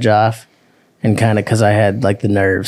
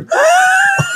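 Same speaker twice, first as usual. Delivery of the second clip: shrieking laugh. Real, not acted. Bursts out laughing.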